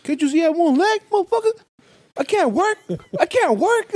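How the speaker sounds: high, strained beggar's yell; hammed-up angry panhandler shout; mock one-legged beggar yelling. Beggar yelling at the driver who refused him, high and strained. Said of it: "like that, all loud".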